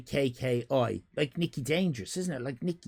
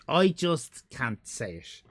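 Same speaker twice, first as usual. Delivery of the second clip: normal talking voice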